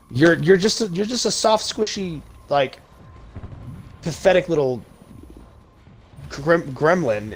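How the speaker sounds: real fury boiling over; passionate rage. Real, not acted. Insulting cowardly leaders to his viewers, furious. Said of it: "I seem a little passionate".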